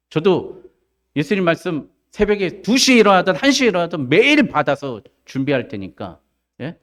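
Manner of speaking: ordinary delivery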